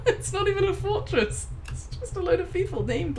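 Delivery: high laughing speech. Real, not acted. Gamer laughing high at the absurd fortress. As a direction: laugh through the words.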